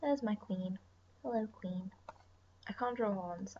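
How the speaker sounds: usual speaking voice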